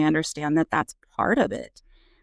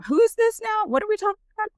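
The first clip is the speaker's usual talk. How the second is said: much higher-pitched mock confusion; mock bewilderment; faster than usual. Mom reacting to girls' school stories, mock bewildered. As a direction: act baffled in a very high voice.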